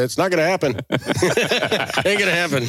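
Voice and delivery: laughing. Real, not acted. Laughing at blanket mute.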